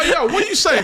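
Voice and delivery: high, indignant comeback with a laugh near; retold challenge. Driver challenging a kid's veiled threat, high mock indignation. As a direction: act out the protest, pitch jumping up.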